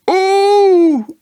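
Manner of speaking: high squeaky ooh; a jolted little ooh; Piglet's ooh. Acted.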